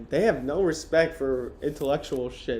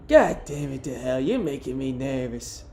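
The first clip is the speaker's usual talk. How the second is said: a hint of a nervous woman, put-on timbre; woman's voice. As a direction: lightly put on an exasperated woman's voice. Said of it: "woman's voice better than that".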